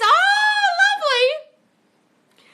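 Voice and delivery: very high, drawn-out sarcastic "lovely"; mock delight. Coach mocking a viewer's comment in a high, sugary voice. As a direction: gush it in fake sweetness, stretching the word.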